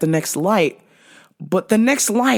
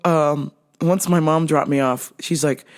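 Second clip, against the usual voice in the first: normal talking voice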